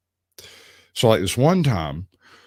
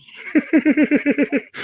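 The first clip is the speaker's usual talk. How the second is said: laugh. Real, not acted. Laughing at his own story.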